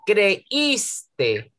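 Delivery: "Creíste" split as cre-ÍS-te; slow syllabified "Creíste" with a strong Í. Shown as a demonstration. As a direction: stretch and stress the Í in "Creíste". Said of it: "una I fuerte, como una vocal abierta".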